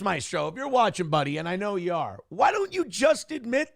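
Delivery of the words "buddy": usual speaking voice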